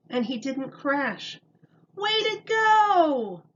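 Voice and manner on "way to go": slow, high, loud cheer; mother cheering her son on, drawn out; proud whoop stretched long. A narrator's reading. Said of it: "cheered his mother".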